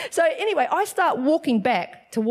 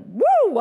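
quick high, loud "woo"; high whoop; 9 semitones higher, 5 dB louder than usual. Real, not acted. Preacher realizes that was only point one, whooping. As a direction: whoop to shake off a long point.

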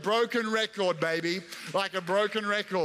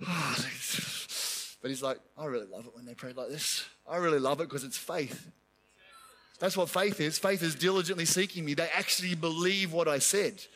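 secretly pleased God; 6 semitones lower, 4 dB quieter than usual. Retold or imitated.

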